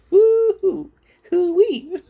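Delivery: high, loud excited whoop. Real, not acted. Vlogger hyping up a creator she watches, loud high whoop. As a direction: whoop like a fan cheering. Cheering about Cobra.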